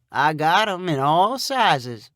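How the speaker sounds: slow, easy Southern drawl; slow country man. A narrator's reading. Sonny boasting to Bryn on the phone, slow country drawl. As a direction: let it roll out lazy and sure.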